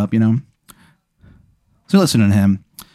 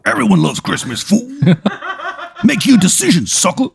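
high, tough taunting voice with a laugh; Mister T voice; 12 semitones higher than usual. Acted. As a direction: taunt him like a cocky bruiser. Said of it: "talks exactly like Mister T".

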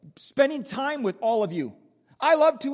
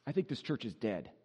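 dismal churchgoer; 12 semitones lower, 10 dB quieter than usual. Retold or imitated. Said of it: "you have this sense of".

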